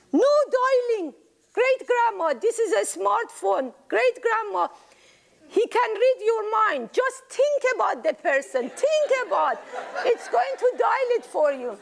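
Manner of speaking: put-on high laughing kid voice; giggly child voice. Child explains smartphone laughing.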